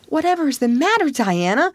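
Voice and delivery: Anne, high and fast, alarmed; startled high outcry, quick; alarmed girl calling out to her friend; cried out. A narrator's reading. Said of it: "cried Anne".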